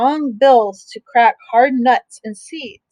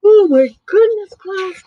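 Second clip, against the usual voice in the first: surprised exclamation; 8 semitones higher, 5 dB louder than usual. Real, not acted. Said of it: "what is happening to me?".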